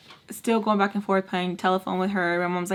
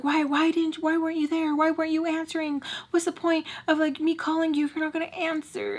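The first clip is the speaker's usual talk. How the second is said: high-pitched pleading complaint; retold crying plea; 8 semitones higher than usual. Retelling her pleas to him for not answering, mock-tearful and high. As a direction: plead in a much higher voice, imitating upset. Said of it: "I've literally cried to him".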